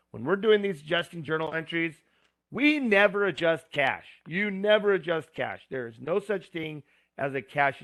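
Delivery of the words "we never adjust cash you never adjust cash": firm teacher's warning, raised pitch and louder; emphatic rule for students to remember; stress on never. Real, not acted. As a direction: hammer home that you NEVER adjust cash.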